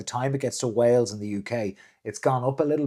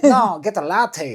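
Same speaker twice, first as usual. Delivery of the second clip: mock teasing friend; 8 semitones higher, 6 dB louder, slower than usual. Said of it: "But it's like".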